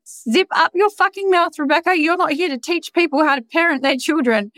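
high, mock self-telling-off; scolding inner voice. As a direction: snap at yourself in a high put-on voice. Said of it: "But I was like".

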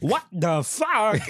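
slow, high drawn-out Walken; Christopher Walken. Retold or imitated. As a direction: stretch it slowly with odd stress. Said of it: "Christopher Walken when he walks in".

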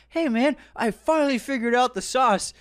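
high, breezy mock Marcus; oblivious cheerful Marcus. Marcus cheerfully interrupting Carmen's meltdown, high and breezy. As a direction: chirp it like nothing's wrong. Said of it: "walks up to him like".

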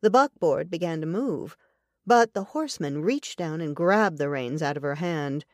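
ordinary talk

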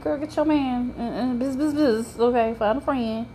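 put-on high, sassy girl get your man; high-pitched sassy biz biz; sassy aside. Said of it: "I'm like".

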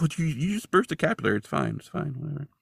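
dismissive Scully. Acted. Scully brushing off the bleeding widow, light dismissive brush-off. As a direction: wave it off lightly, trailing to nothing. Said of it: "And Scully's like".

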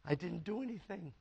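hushed, very soft imitation of a crying wife; barely audible, wounded protest; retold tearful plea. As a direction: murmur it helplessly, as if through tears. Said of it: "she's crying, going".

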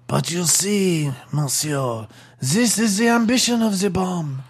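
measured, theatrical French bomb; French accent. Acted. Bomb explaining its ambition to blow up, theatrical French accent. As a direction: explain it patiently in mock French. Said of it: "It's got eyes and a French accent".

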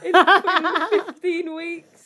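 choked-up laughing, slow; laughing through tears; emotional laugh. Real, not acted. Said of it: "Why am I getting emotional".

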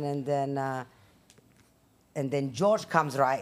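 normal talking voice

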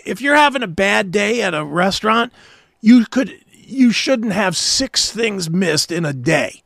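ordinary delivery

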